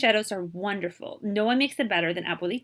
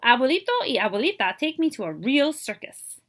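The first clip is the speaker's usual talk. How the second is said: usual speaking voice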